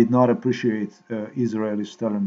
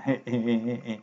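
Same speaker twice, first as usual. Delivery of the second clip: short chuckle; 5 dB quieter than usual. Real, not acted. Vlogger finding the space laser video kind of funny, light chuckle.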